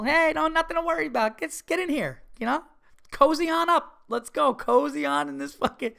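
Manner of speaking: high-pitched, eager mock welcome; hearty welcome. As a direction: usher them in with exaggerated friendliness. Acting out the welcome he wanted.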